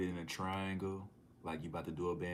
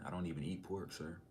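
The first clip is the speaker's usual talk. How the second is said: usual speaking voice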